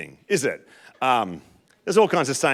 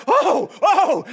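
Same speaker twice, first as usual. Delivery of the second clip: high, loud delighted cry; excited oh; 5 dB louder than usual. Retold or imitated. Husband sees the jammed poles free, delighted cry. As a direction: burst out as the poles come free. Pieces come apart, relief.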